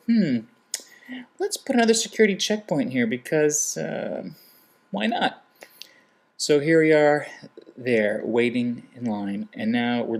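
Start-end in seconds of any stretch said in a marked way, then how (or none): 0.1-5.4 high, mocking airport-planner voice; sneering take on whoever added another checkpoint; sarcastic mock planner. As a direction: play the careless official, pitched up in scorn.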